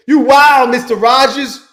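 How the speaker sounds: high, loud excited greeting; real thrilled hello to a viewer. Real, not acted. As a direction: shout the name out happily.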